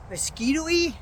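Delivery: amused self-query. Real, not acted.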